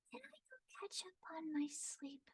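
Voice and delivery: high, airy, breathy sleepy whisper; Sayu's sleepy voice. Shown as a demonstration. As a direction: sigh it out, half asleep. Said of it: "about to fall asleep".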